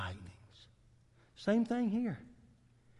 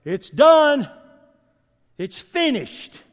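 booming, louder declaration of God; God's proclamation; 4 semitones higher, 9 dB louder, much slower than usual. Acted. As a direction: proclaim it like God's final word. And God says.

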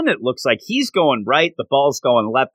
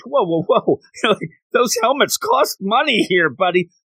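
mock coach; 7 semitones higher than usual. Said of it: "the coach".

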